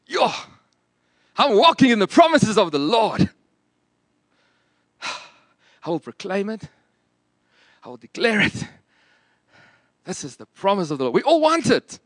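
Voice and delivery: hammed-up American preacher proclaiming promises; clumsy mock-American declaration; attempted American accent. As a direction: put on an exaggerated American accent, declaring with lifted pitch. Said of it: "trying my best to do an American accent".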